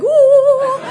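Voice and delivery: long, loud, high "ooh!"; spooky ghost hoot. Retold or imitated. Creeping up behind haunted trail guests, ghostly hoot. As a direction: hoot like a ghost to scare them. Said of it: "and I would be like".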